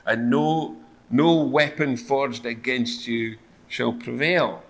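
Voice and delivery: normal talking voice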